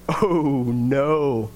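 low, sinking oh no, dropping away; dismayed oh no. Retold or imitated. Recalling being overheard, a low dismayed oh no. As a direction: let it sink as you realize you're caught. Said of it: "And I was just like".

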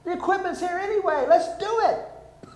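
high, loud scolding; lady berating the karaoke guy; chewing him out. Retold or imitated. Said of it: "she chewed him out".

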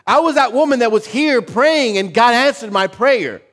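high, loud and fervent; Hannah testifying. Retold or imitated. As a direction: proclaim it triumphantly. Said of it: "She's like".

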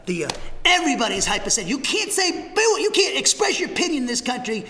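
normal talking voice

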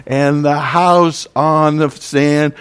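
very slow and loud, shouting out "smash"; shouted song finale. Shown as a demonstration. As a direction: belt out the crash at the end of the song. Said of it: "But there's another verse".

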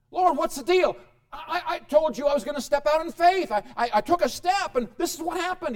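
hurt believer protesting to God; complaining believer. Acted. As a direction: whine at God like he let you down.

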